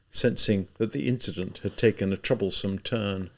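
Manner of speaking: usual speaking voice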